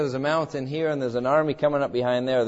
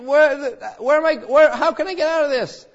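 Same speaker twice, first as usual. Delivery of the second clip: ordinary delivery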